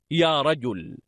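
normal talking voice

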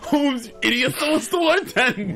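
laughing. Retold or imitated. Quoting punchline, laughing.

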